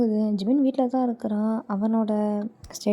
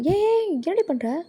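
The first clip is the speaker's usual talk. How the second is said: high, drawn-out startled question; dismayed cry of disbelief; alarmed challenge; 7 semitones higher, slower than usual. Acted. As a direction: catch them in the act, pitched up and slow. Catches her drinking.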